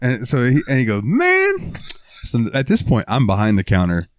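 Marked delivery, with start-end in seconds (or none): 1.0-1.8 very high, squeaky "Man."; goofy customer voice. Retold or imitated. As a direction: squeak it like a cartoon oddball. Odd customer voice.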